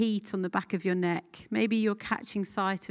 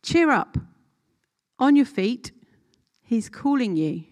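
crowd's call read out louder and higher, brisk and bright; lively first reading; 4 semitones higher, 9 dB louder than usual. Shown as a demonstration. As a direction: call Cheer up out as if the crowd shouts it. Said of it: "read it again slowly".

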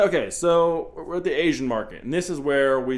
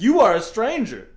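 higher, louder mock protest; mock indignant; 7 semitones higher, 8 dB louder than usual. Kidnapped girl confronting her captor, mock indignant. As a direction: push it out in pretend outrage.

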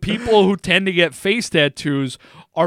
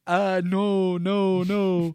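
put-on slight Indian accent on a quote; coworker's Indian accent; 2 semitones higher, much slower than usual. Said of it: "Did I do a little accent there?".

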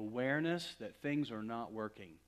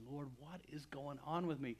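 retold crying out; 5 semitones higher, 6 dB louder than usual. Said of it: "crying out to God".

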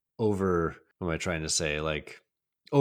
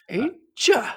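high squeaky taunt; Crazy Ralph; 5 dB louder than usual. Retold or imitated. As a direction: sing out the taunt. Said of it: "my Crazy Ralph impression".

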